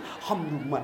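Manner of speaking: low, droning mock recitation; intrusive recitation. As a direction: imitate someone reciting right into a neighbour's ear. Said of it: "kayak mau nagigi telinga tadi samping".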